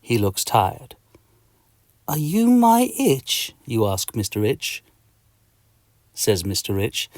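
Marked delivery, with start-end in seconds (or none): 2.1-3.5 slow wonder. Acted. Hero asks a tiny creature.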